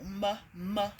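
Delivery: "M, m" said as two short muh sounds; letter sound. Shown as a demonstration. Teacher isolating the first sound, M as a short muh. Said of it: "begins with an M".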